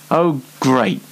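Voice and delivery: normal talking voice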